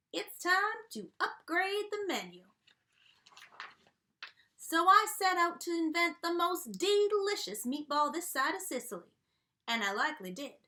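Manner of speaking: drawling cowboy storyteller voice; a jolly country restaurant owner spinning his yarn; folksy dog chef. Acted. Reading to kids as Yodeler Jones, folksy country voice.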